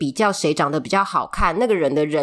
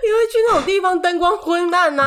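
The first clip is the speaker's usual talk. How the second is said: laughing; 11 semitones higher than usual. Real, not acted. Teasing co-host, laughing.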